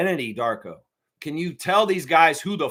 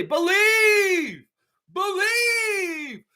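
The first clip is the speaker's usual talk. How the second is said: crying out believe; 12 semitones higher, much slower than usual. Real, not acted. Urging fans to believe.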